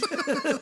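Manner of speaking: short high burst of laughter; amused laugh. Real, not acted. Host laughing at their own retold mix-up.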